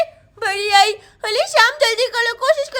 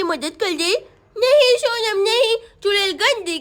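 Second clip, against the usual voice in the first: normal talking voice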